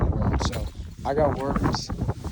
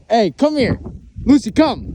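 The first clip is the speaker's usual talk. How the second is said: loud, high call to the dog; 10 semitones higher, 5 dB louder than usual. Real, not acted. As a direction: call the dog back firmly. Calling the dog back.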